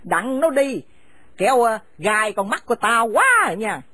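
harsh, contemptuous scolding of a daughter-in-law; bitter older woman driving her son's wife from the house; angry scolding mother. Acted. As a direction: spit the insults at her and order her out. Mother orders them out.

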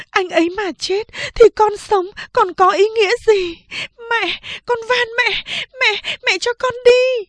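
very high, pleading cry; desperate begging. Acted. Second sister desperately begging mother to let her go. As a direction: beg with everything.

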